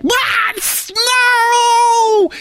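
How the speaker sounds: high startled yell; panicked shout. Retold or imitated. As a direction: shriek in alarm at snow. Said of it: "everybody's like".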